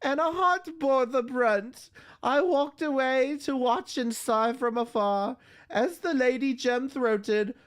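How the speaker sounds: high, slow recital voice reading a poem. Acted. Streamer reading a poem aloud to chat, high and slow. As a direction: lift each line up high and linger on it.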